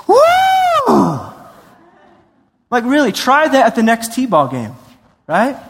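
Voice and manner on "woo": very high, loud whoop; cheering whoop. Pretend. Father whooping before the game, excited whoop. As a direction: whoop from the stands. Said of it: "the Father was like".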